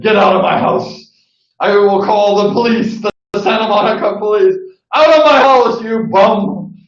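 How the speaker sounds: mock-angry threat, rising to a loud high yell at the end; hammed-up fury, building to a shouted "you bum"; angry eviction. Imitating Sonny threatening to call police on a party crasher, building to a mock yell. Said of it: "And he said".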